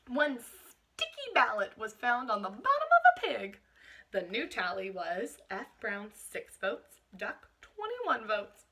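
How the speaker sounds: slow, expressive picture-book reading; picture-book narration for a class; storybook reading. Acted. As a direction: let the result land for the children. Story read aloud to class.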